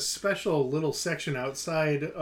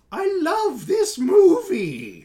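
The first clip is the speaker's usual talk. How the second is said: mock gushing fan; 13 semitones higher, 9 dB louder, much slower than usual. Reading a short viewer review.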